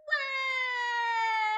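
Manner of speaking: loud, high, sustained "wah"; mock crying wail. Boy told he is no dragon, hammed-up bawling. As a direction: wail like a storybook crybaby. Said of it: "you made him cry".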